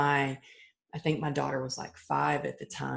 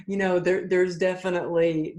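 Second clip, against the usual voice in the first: normal talking voice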